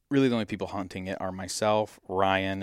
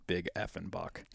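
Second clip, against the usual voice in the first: hushed exclamation; 9 dB quieter than usual. Retold or imitated. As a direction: keep it down in the stand, "Big effing buck". Hunter whispering in the stand.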